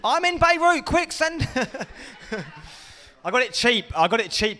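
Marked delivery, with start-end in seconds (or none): 0.0-1.5 high, hurried plea to send it fast; a buyer in a war zone begging for quick delivery; urgent mock order. Joking about ordering body armour, high urgent plea.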